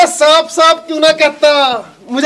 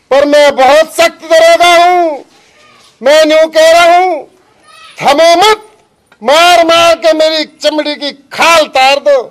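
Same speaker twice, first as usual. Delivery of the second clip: loud, slow, pompous command; blustering order; 2 semitones higher, 5 dB louder than usual. Acted. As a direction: bellow like a self-important inspector. Strict inspector demands a beating. (सख्त दरोगा की ऊँची गरज)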